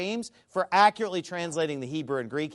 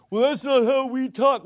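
mocking imitation; 5 semitones higher, 5 dB louder than usual. Quoting the Australian critic.

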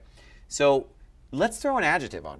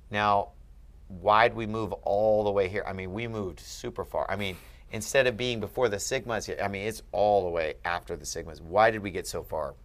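usual speaking voice

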